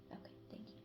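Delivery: soft unvoiced whisper. Real, not acted. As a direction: whisper it as a quick aside. Whispered aside after cussing.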